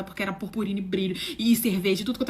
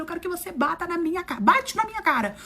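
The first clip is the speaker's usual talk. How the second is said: high, insistent challenge; retold defiant dare; 8 semitones higher than usual. Nervous teller confronting a woman on the metro, heated dare. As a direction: dare them, pitch pushed right up.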